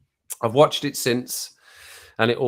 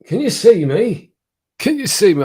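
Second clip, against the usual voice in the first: much higher, louder, swooping question; high sing-song call; 8 semitones higher, 8 dB louder than usual. Real, not acted. Streamer to viewers after sun glare, high sing-song call. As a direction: call out playfully to check you're seen.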